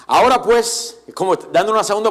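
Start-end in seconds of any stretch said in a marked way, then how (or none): 0.1-1.0 Nebuchadnezzar voice. Retold or imitated. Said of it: "imitar un poco la voz de Nabucodonosor".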